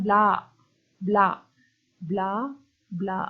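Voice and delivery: "ब्ला" repeated singly with clear pauses; isolated short "ब्ला" beats; sound demo. Shown as a demonstration. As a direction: say each "ब्ला" alone, then wait. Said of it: "तो sound किसका आ रहा है?".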